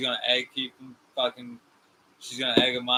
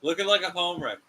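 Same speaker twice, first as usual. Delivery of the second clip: higher and louder, punching it out; emphatic repeat; 5 semitones higher, 5 dB louder than usual. Real, not acted. Co-host repeats the jab louder to make his point. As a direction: hammer the insult home the second time.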